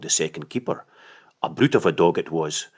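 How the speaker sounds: plain reading voice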